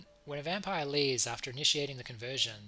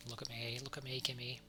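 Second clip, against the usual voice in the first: urgent soft plea; 11 dB quieter than usual. Acted. Unicorn calming dying human.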